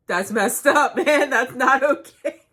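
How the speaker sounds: loud, high mock outrage through laughter; indignant scolding. Shopper scolding the man who farted nearby, indignant.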